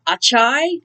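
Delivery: "Achai" with a ch sound, rising like a question; mispronounced acai. Retold or imitated. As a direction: imitate a puzzled attempt, saying "Achai" with a ch. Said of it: "listening to people try and pronounce it".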